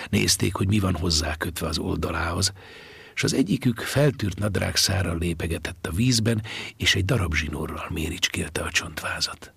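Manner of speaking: ordinary delivery, nothing marked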